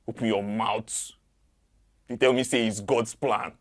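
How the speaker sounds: angry confrontation. Acted. Confronting someone who said God's plan.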